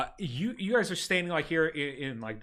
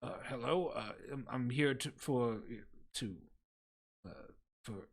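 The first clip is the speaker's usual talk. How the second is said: softer, halting, hesitant mumble; nervous applicant; 4 semitones lower, 10 dB quieter than usual. Acted. Timid applicant stammers at the clerk's desk. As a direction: trail off, lose your nerve. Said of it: "next person's like".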